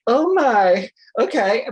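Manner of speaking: high, loud startled reaction; acted pained shock. Client reacting to a painful laser zap, acted shock. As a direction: reel from a retold zap.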